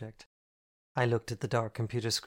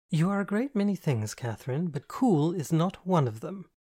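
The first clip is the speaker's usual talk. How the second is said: knowing camp friend; 6 semitones higher, 4 dB louder than usual. Acted. Andy sees Catherine is smitten, higher, louder, gleeful. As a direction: catch her out, gleefully. Andy's flamboyant voice.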